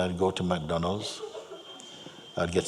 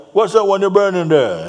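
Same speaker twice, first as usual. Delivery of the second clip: loud, high mock demand; crusty Jamaican man; 11 semitones higher, 10 dB louder than usual. Jamaican man asks about the burning incense, loud and high. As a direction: ask it at full volume, pitched well up. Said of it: "He says".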